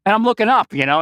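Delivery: ordinary talk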